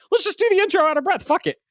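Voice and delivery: quick, high-pitched quoted decision; devil-may-care. Retold or imitated. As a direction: toss it out fast and high, throwing caution aside. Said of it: "And I was like".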